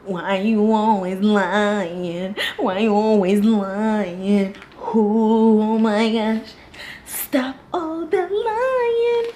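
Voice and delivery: slow, drawn-out fed-up scolding; exasperated scolding. Retold or imitated. Scolding the lying husband, slow and exasperated. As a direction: drag each word out in disbelief. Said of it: "I was just like".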